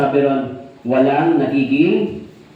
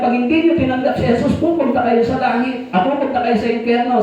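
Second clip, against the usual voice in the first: mock preacher; 9 semitones higher than usual. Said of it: "sabi niya".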